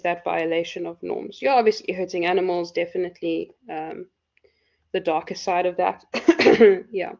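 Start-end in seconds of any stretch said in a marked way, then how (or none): 6.1-6.9 single dry cough. Real, not acted. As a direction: clear the throat with a cough. Lecturer coughs mid-talk.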